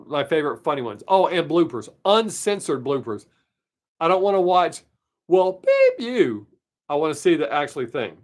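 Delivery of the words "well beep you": mock bleeped-out line. Mocking a censored blooper.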